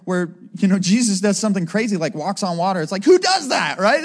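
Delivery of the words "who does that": high, louder mock astonishment; hammed-up incredulous outburst. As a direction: exclaim in acted disbelief. Acts disciples amazed at walking on water.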